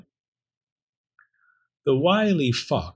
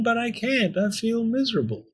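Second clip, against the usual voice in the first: high, helpless lament; miserable; 11 semitones higher, 3 dB quieter than usual. Acted. Talking bone unable to save Pearl, miserable. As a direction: confess it miserably, pitched high.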